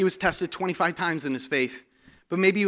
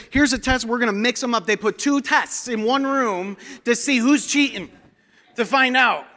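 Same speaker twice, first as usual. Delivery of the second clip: higher, louder preacher's drive; full-force preaching; 8 semitones higher, 5 dB louder than usual. Acted. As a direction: preach it out to the congregation.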